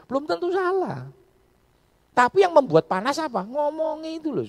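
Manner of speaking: high, loud pulpit exhortation; impassioned preaching. Acted. Pastor on harsh public speech, preaching loudly. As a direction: press the point hard.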